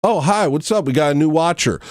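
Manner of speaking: sing-song greeting; mock friendly greeting. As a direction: mimic a fake-friendly hello. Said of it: "your freaking introduction to me".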